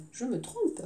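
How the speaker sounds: ordinary talk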